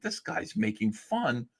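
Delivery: hushed mock indignation; mock outraged critic. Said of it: "everybody said".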